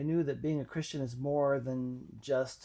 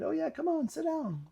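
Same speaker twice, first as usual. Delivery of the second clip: much higher, sing-song welcome; welcoming girl. Retold or imitated. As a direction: warmly wave him in. Girl welcomes him to study.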